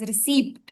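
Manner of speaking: "receipt" with the P sounded; common mispronunciation. Shown as a demonstration. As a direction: say "receipt" ending in an audible P-T. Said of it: "usually people pronounce it as".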